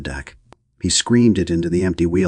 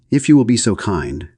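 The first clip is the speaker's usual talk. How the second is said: higher, louder call after him; screaming after; 5 semitones higher, 5 dB louder than usual. A narrator's reading. Hostess to the departing gentleman, a mild scream. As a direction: shout it after someone leaving. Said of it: "Missus Morier screamed after him".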